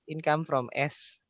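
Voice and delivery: ordinary delivery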